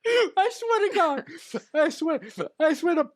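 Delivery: exasperated. Real, not acted. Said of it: "exasperated disbelief".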